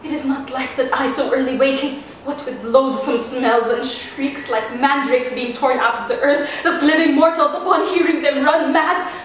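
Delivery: fear building to frenzy; mounting hysterical dread onstage; Juliet. Acted.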